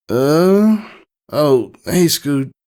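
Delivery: lightly voiced, half-awake mare; just woken, still drowsy; a touch of sleepiness; groggy. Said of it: "groggily said the words".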